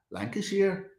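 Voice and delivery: unsure stab at Lancashire speech; attempted Lancashire accent. Streamer testing an accent on viewers, attempted Lancashire accent. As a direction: try out a Lancashire accent, questioningly. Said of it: "Is it kinda like that?".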